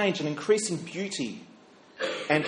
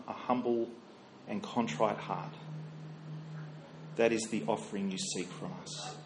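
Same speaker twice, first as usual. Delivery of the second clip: low, soft, measured praying voice; prayer; 5 semitones lower, 7 dB quieter than usual. Real, not acted. Minister leading the morning prayer to Heavenly Father, hushed and reverent. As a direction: pray quietly, with long reverent pauses.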